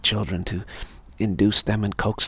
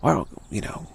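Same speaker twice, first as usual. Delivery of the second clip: ordinary talk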